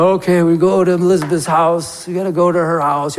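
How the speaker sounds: unenthused, monotone mock reluctance; dragging her feet. Preacher mimics a reluctant visit to Elizabeth's, unenthused. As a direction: act out a half-hearted, plodding visitor. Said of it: "dragging her feet".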